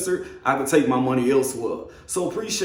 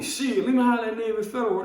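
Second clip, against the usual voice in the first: higher and faster, suddenly hyped; excited; 7 semitones higher, faster than usual. Retold or imitated. Excited retold thought, high and fast.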